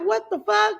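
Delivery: high-pitched exasperated outburst; retold alarm. Mother finding no missed call, high retold alarm. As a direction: blurt it out high, in mock panic.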